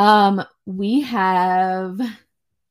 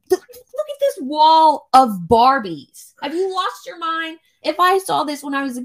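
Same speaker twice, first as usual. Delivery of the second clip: playfully aghast, voice pitched up; retold disbelief; 8 semitones higher, 3 dB louder than usual. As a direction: exclaim it high, stretching out the words. Retold disbelief at child's toy choice.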